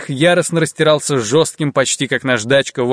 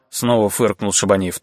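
ordinary delivery, nothing marked

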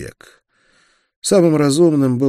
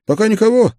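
much higher, louder, quick question; partner's query; 7 dB louder, faster than usual. A narrator's reading. Busy partner asks whether anyone is coming, high raised query. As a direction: toss out a high, keen question.

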